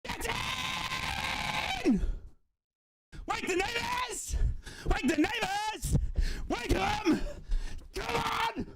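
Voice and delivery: high, drawn-out triumphant shouts; real goal celebration. Real, not acted. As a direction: shout to wake the whole street.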